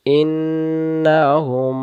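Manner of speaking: drawn-out chanted word; slow recitation. Shown as a demonstration. Teacher chants the verse word for the class. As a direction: stretch every syllable in a chant. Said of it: "তারপরে".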